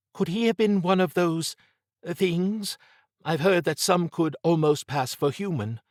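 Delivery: high-pitched, hesitant question; Trish, wondering. Acted. Voices Trish in dialogue.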